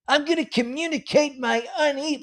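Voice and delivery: mocking the husband.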